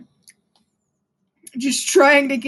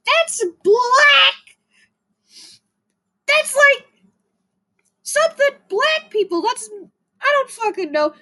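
put-on much higher sneering girl voice; imitating a twelve-year-old girl lecturing about a hairstyle, much higher; mocking girl voice; 10 semitones higher than usual. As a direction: mimic a preachy twelve-year-old, shrill and sure. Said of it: "girl is gonna fucking find it and say".

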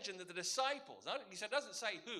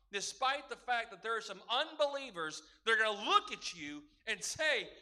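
ordinary talk

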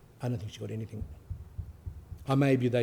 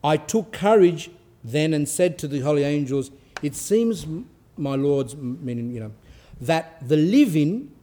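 loud, slow solemn reading; dramatic reading aloud; 5 semitones higher, 5 dB louder than usual. Acted. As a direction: read the holy text with gravity. Continues reading the angels' words.

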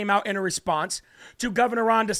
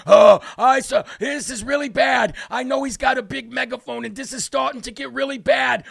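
whiny mocking impression, a bit higher; hammed-up imitation of a scared official; mock Fauci voice; 3 semitones higher, 5 dB louder than usual. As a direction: mimic a rattled old man.

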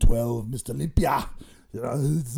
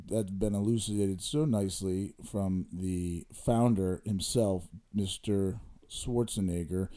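lower, slower, formal announcer read; 5 semitones lower, 4 dB quieter than usual. Acted. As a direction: read it like a polished sponsor spot.

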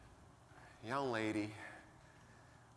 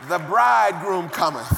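laughing quote; 4 semitones higher, 9 dB louder than usual. Retold or imitated. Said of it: "And I said".

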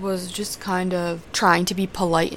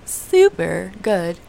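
drawn-out emphasis; 4 dB louder, much slower than usual. Real, not acted. Stretching the final super.

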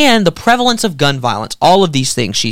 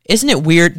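ordinary talk

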